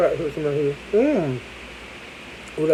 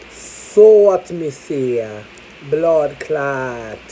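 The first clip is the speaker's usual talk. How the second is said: playful Jamaican patois exclamation; mock Jamaican "blood clot" outburst; Jamaican accent; 3 semitones lower, much slower than usual. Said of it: "Jamaican patois exclamation".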